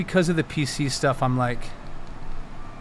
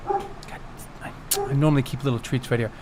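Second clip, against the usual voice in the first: normal talking voice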